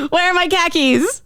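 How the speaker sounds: high, broad Boston accent. Shown as a demonstration. Host demos the Boston khakis line in a Boston accent. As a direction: whine it like a Bostonian hunting keys. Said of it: "Sounds like I lost my car keys".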